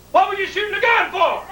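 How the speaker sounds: high-pitched demand; indignant dad. Retold or imitated. Dad asks Bill about the gunfire, indignant.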